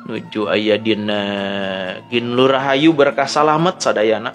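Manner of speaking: ordinary delivery, nothing marked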